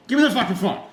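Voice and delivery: loud, high-pitched exasperated order; retold impatient demand. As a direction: imitate a friend taking charge.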